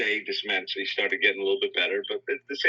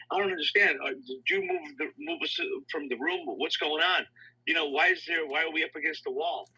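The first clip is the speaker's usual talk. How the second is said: higher, bewildered pleading voice; retelling the patient's confusion in a higher voice; confused patient; 8 semitones higher than usual. Retold or imitated. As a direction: voice the puzzled man asking the nurse, higher.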